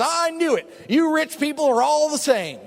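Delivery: lady storming out, imitated indignant outburst; mock angry lady. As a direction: spit it out like a resentful woman.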